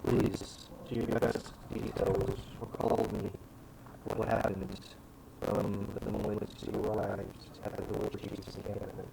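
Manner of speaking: low, soft formal interrogator; lightly voiced inquisitor. Inquisitor opens the questioning.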